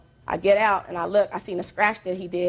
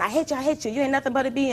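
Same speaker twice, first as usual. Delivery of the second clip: higher-pitched mock yell at normal volume; retold yelling; 6 semitones higher, faster than usual. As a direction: imitate his yelling without really shouting. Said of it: "he's still yelling".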